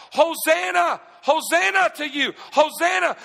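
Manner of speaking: loud, high cries of hosanna; crowd shout. Retold or imitated. Preacher voicing the crowd hailing Jesus, loud shouts.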